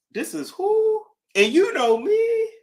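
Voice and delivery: very high, incredulous squeal; starstruck disbelief. Acted. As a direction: act amazed they know you, pitch way up. Acts out reacting to an email.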